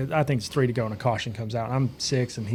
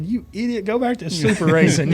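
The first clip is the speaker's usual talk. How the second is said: angry retort; 8 semitones higher, 4 dB louder than usual. Retold or imitated. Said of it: "I was so mad".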